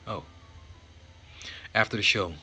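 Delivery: ordinary delivery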